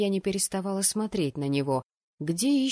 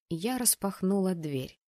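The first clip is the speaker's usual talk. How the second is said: plain reading voice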